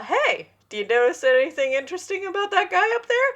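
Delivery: high, fast sly hint; teasing boyfriend. Retold or imitated. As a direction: nudge her slyly toward the joke.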